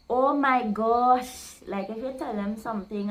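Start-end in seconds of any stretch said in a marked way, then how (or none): 0.1-1.6 slow, drawn-out "Oh my gosh" in wonder; amazed oh my gosh. Shown as a demonstration. Teacher showing how people react to good news, slow and amazed. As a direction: stretch out "Oh my gosh" like hearing great news. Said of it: "Other people would say".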